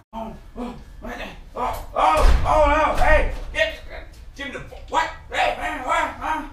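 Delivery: starts hushed, then bursts into a high shout. Acted. Same panic repeated, quieter start.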